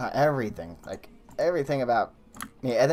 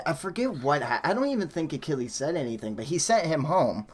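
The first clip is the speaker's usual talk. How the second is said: ordinary talk, nothing marked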